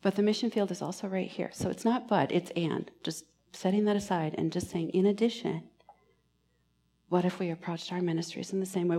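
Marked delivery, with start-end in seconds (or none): none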